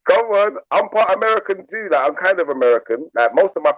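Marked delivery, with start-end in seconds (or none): none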